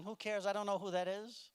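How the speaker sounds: usual speaking voice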